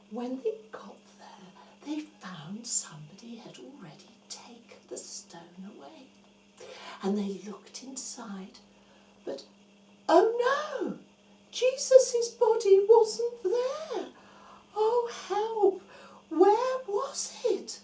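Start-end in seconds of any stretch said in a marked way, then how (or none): 0.1-6.1 whispered, building suspense; hushed storytelling. A narrator's reading. Storyteller whispering suspense to the children. 10.1-17.8 high, panicky gasp of dismay; alarmed cry. Acted. Women at the empty tomb, high alarmed cry.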